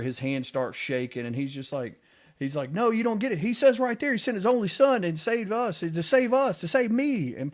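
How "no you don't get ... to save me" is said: much higher and louder, a burst of discovery; eager, pitched-up retold exclamation; excited. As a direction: retell the man's excitement, voice jumping up high. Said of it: "He's all excited".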